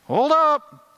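high, loud startled people; startled crowd. Retold or imitated. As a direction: blurt it like the people catching on. People voiced in a story.

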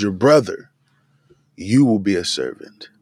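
"brother" fronted, then a long pause; "brother" stressed, the rest falling away after a beat. Shown as a demonstration. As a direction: set "brother" apart before finishing the sentence. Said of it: "it gives prominence to that".

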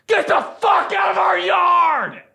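high, drawn-out furious holler; mad janitor yell. Retold or imitated. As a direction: holler out the window at trespassing kids. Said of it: "channel, like, mad janitor".

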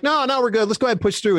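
high, insistent acted reply; brushing off concern. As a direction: wave off the worry, pitched well up. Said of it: "And I'm like".

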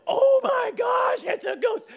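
high, loud, drawn-out panic; hammed-up shriek of alarm; freaking out. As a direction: freak out like a terrified crew. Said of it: "disciples are kind of freaking out".

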